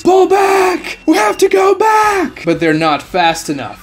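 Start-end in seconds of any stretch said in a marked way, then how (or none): 0.0-2.4 panicked shout. A narrator's reading. Captain ordering the retreat, panicked shout. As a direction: scream for retreat. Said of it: "yells to the remaining ninjas".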